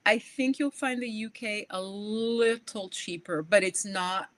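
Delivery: usual speaking voice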